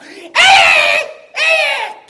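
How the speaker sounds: very high, loud fighting yell; kung fu shout. Pretend. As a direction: belt it like an action hero. Jackie yell to kids.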